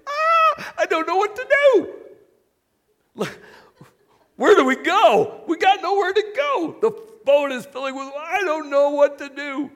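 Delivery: high, loud, frantic cry of fear; panicked disciples. Retold or imitated. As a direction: play terrified sailors in a sinking boat. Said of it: "I think of them just going like".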